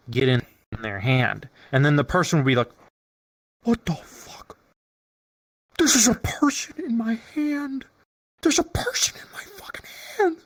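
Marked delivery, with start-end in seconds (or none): 3.6-10.4 high breathless disbelief; stunned whisper. Retold or imitated. Newbie finding himself held in a hand, high stunned whisper. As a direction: gasp it out in shock.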